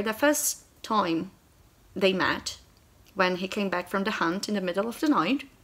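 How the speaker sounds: normal talking voice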